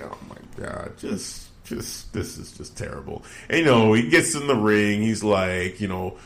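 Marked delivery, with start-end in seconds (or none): 0.0-3.2 whispered dismay. Retold or imitated. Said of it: "And I'm like".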